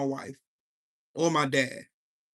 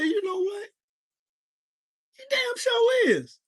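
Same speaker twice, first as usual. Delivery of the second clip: high-pitched, drawn-out sarcastic jab; mock high sarcastic reply. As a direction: play it up high and mocking, slow at the end. Mocking reply to lawyer.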